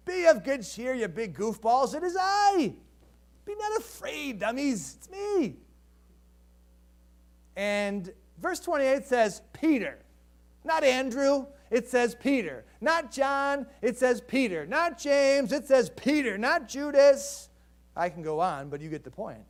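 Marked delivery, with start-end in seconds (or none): 0.1-5.6 high, slow teasing reassurance; playful Jesus. Acted. Jesus calling to scared disciples, playful teasing. 9.5-17.5 louder, stress on Peter each time; emphatic list. Real, not acted. Preacher insisting to congregation, emphatic build. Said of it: "building emphasis on Peter".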